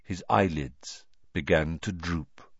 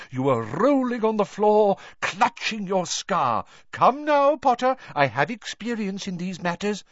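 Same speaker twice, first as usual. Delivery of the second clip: eccentric seer voice; 12 semitones higher than usual. A narrator's reading.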